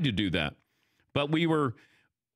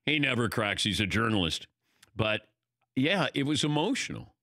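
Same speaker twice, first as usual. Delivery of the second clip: ordinary talk, nothing marked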